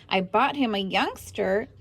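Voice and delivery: ordinary delivery, nothing marked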